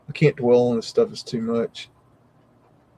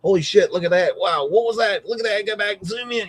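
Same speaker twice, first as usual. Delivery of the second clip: yelling at the screen; 6 semitones higher than usual. Retold or imitated. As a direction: shout your disbelief at the pictures. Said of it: "screaming at the screen".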